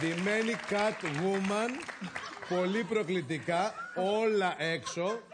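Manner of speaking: slow, drawn-out declamation; dramatic reveal. Acted. Man exposing the widow's costume, slow and dramatic. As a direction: stretch each phrase for scandalous effect.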